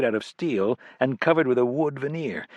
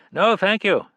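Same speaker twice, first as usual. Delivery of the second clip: lightly voiced stranger, higher and louder, brushing off help; a hint of a wary stranger cutting it short; curt male stranger; 10 semitones higher, 6 dB louder than usual. A narrator's reading.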